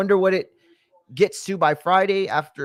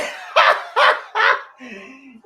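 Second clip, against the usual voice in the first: amused laugh; 8 semitones higher than usual. Real, not acted. Reacting to [name] line.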